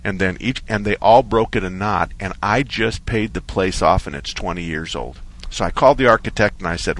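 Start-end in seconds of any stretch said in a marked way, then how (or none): none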